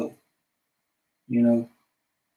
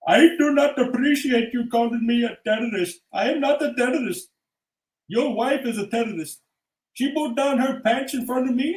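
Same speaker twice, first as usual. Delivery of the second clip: higher mock Indian accent; mock Indian accent rant; 5 semitones higher than usual. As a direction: scold the customer in accent. Voiceover shopkeeper rant.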